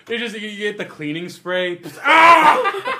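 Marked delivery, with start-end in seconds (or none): 2.0-2.9 high shriek through laughter; laughing shriek. Pretend. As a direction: squeal with a laugh. Mock scream with cleaning spray.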